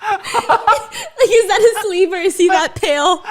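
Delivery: high, helpless laughter through words; can barely talk for laughing. Real, not acted. Cracking up over memes.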